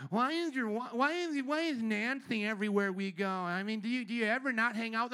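mocking impression of whiny weaklings. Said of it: "They talk like weaklings".

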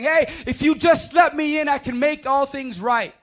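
loud, high pleading call through the door; Christ calling out. Acted. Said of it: "and he's saying".

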